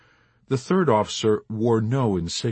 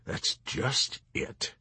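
slow, breathy, much softer bitterness; bitter; 2 semitones higher, 7 dB quieter, much slower than usual. Acted. Major answering the chaplain, quietly bitter. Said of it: "the major answered bitterly".

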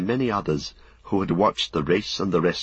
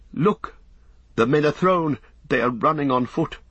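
high, alarmed lookout; urgent exclamation; crying out; 7 semitones higher than usual. A narrator's reading. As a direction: call out the disaster as it unfolds, pitched up. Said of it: "cried Beregond".

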